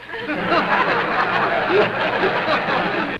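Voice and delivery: breathy puppet laugh; dummy chuckling; dummy cackle. Acted. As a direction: laugh at your own joke. Dummy laughs at own flirt.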